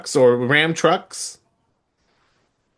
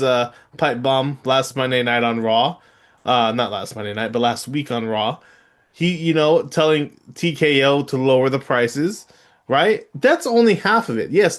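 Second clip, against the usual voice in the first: normal talking voice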